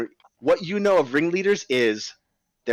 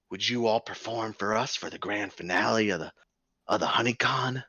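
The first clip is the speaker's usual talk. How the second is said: hushed showman; 5 semitones lower, 6 dB quieter than usual. Acted. Ringleader drops voice to conspire.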